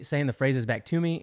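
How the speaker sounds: ordinary delivery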